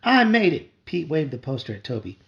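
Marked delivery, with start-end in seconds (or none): 0.0-0.7 shouting. A narrator's reading. Brothers fighting over poster.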